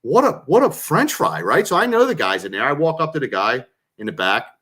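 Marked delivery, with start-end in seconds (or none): 0.0-1.4 mock awe. Retold awe at the fries.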